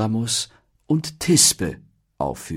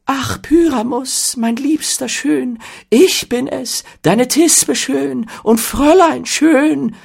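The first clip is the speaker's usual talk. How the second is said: loud, slow, piercing falsetto maiden; bad amateur actor's squealing lady voice; hammed-up Thisbe; 10 dB louder, slower than usual. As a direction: play the lovesick damsel absurdly high. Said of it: "mit mächtig leiser Stimme sagen".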